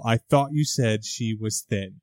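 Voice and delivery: mock catty aside, voice dropped low and soft; snooty Laura. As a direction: purr it out with cold disdain. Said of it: "has the fucking audacity to go".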